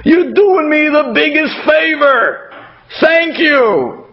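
high, drawn-out effusive thanks; acted over-the-top gratitude; gushing gratitude. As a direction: gush as if you're the one being helped. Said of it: "they tell the person on the receiving end".

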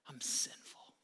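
whispered confession. Acted. Isaiah whispering his confession of sin. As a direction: breathe the confession out.

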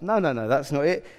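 usual speaking voice

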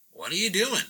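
put-on Southern accent. Truck owner confronts a painter in her driveway, Southern accent. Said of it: "she's got a southern accent".

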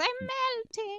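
high slow witch wail. Shown as a demonstration. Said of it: "you got the witch's cackle".

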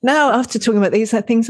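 ordinary talk, nothing marked

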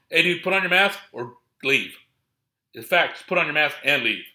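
stern staff shout. Retold or imitated. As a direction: bark it like security laying down the rule. Staff telling fan to mask.

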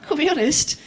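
normal talking voice

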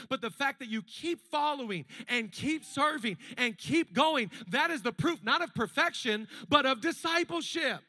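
loud, rising preacher's call to the crowd; full-force preaching. Acted. Pastor rallies the congregation about failure, loud preaching. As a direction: preach it out to the back row.

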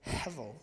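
Hevel said HEV-el with a breathy h; Hevel, two distinct syllables with puffs of air. Shown as a demonstration. Preacher demonstrating the word for the congregation, breathy and clear. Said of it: "Now say the word hevel, being aware of its two syllables".

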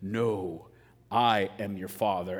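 low, measured villain voice; Darth Vader. Acted. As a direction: drop deep for the grave reveal. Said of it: "And then Darth Vader says".

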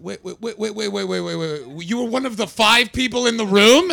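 wait wait, then a loud high burst of disbelief; incredulous interruption. Retold or imitated. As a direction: stop him, then blurt it out amazed. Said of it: "And I was like".

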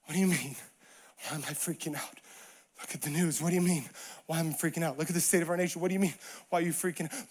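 low, soft, muttered disbelief; muted freak-out. Acted. Imagined conversation with Jesus about the news, hushed exasperation. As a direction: toss the questions back and forth under the breath. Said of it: "if we were to have that conversation with him".

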